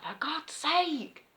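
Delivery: high, slow exasperated wail; retold exasperation. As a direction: stretch it out high, re-enacting fed-up despair. Retells being chased by bees.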